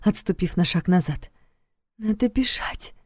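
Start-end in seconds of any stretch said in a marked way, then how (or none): 2.0-2.9 urgent whisper. Acted. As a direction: whisper to flee. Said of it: "всё так же тихо велела".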